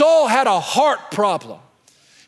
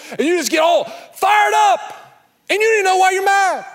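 fired-up preaching; 7 semitones higher than usual. Real, not acted. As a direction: build it to a fired-up peak. Preacher building up.

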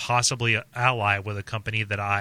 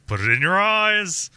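mock pitch; 8 semitones higher, 4 dB louder than usual.

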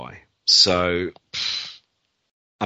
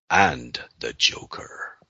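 whispered; 9 semitones lower, 4 dB quieter, slower than usual. Real, not acted. Teasing question to Brad.

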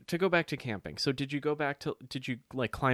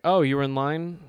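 louder, surprised question; imitating a surprised clerk; mock surprise; 4 semitones higher, 7 dB louder than usual. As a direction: play the clerk caught off guard. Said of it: "The guy ringing me up".

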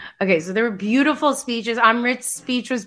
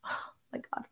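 breathless whisper; 15 dB quieter, much faster than usual. Real, not acted. Overwhelmed watching a moving scene.

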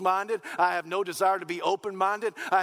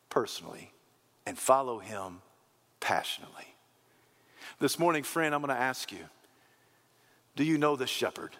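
hushed altar call; 7 semitones lower than usual. Real, not acted. Preacher inviting listeners to trust Jesus, hushed and solemn. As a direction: gently invite them, phrase by phrase.

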